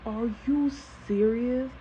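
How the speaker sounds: slow, high-pitched incredulity; retold fed-up thought, drawn out; inner disbelief. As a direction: stretch it out in disbelief. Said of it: "In my head, right?".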